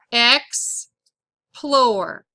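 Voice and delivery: "Explore" broken as EX, long pause, PLORE; "Explore" pulled apart for spelling; syllable by syllable. Shown as a demonstration. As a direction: dictate "Explore" in two separated syllables. Dictating a word by syllables.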